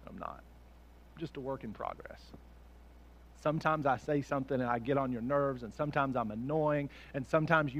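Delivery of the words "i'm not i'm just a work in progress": quiet admission. Real, not acted.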